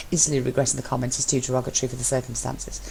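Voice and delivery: usual speaking voice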